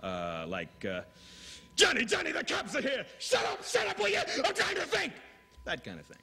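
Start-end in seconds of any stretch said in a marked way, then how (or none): 1.8-5.1 high frantic shouting panic; intense melodrama. Acted. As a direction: scream it like a crime drama climax. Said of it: "Ninety-second dramas that are very intense".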